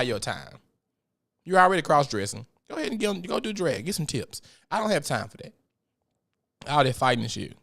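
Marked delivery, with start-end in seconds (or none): none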